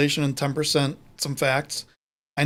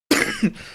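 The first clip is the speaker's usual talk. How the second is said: cough. Real, not acted. Coughing mid-podcast.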